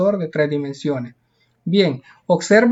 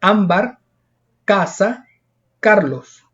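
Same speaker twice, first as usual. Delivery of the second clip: spaced examples ámbar, casa, Carlos, weight on the next-to-last syllable; penultimate stress; slower than usual. Shown as a demonstration. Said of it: "la mayor fuerza de voz en la penúltima".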